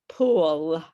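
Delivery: "pool" with a full, clear final l; fully pronounced L. Shown as a demonstration. Teacher correcting a student's final sound, full L. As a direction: finish "pool" firmly on the l. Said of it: "you also don't fully pronounce the L".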